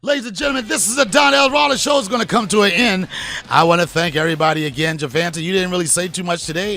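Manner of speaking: loud, booming announcer outro; show host sign-off. Acted. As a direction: close the show like a hype host.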